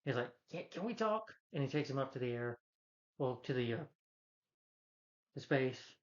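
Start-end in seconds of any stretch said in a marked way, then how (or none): 0.5-1.3 high, soft imitated plea; mock choked plea. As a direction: squeak it as if held by the neck. Mimics character grabbed by the neck.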